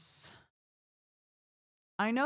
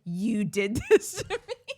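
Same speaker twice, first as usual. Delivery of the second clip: louder, laughing mock accusation; laughing mock complaint; 4 semitones higher, 6 dB louder than usual. Husband blaming her for the habit, laughing complaint. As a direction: blame her while laughing.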